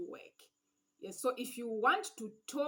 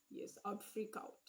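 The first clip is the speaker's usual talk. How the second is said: whispered aside; 10 dB quieter than usual. Real, not acted.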